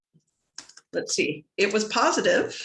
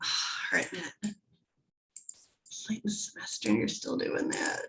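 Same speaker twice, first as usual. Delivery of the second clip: muttering to self; 2 semitones higher, 7 dB quieter than usual. Real, not acted. Scolding herself under her breath.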